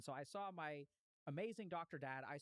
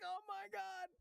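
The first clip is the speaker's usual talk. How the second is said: stunned gasp; 3 dB louder, slower than usual. Retold or imitated. Room reacts to stem eating.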